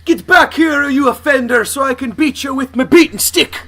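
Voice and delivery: high, loud, botched Scottish brogue; hammed-up bad Scottish policeman; terrible Scottish accent. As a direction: holler in a badly faked Scottish accent. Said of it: "terrible Scottish than anything".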